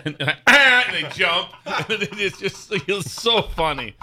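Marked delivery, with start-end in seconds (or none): 0.5-0.8 sudden loud, high honk; imitated horn blast. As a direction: blare a horn to make someone jump. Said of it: "fun in the garage".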